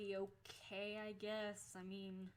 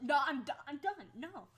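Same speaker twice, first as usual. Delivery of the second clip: high, loud burst that settles on the last word; fed-up refusal; 5 semitones higher, 10 dB louder than usual. Retold or imitated. Student to boys who stole her lunch, fed-up shouting. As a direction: shout them down, completely done.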